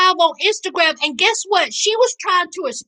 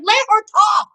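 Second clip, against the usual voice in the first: outraged commenters; 5 semitones higher than usual. Retold or imitated. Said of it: "people in the comments while they were going live was like".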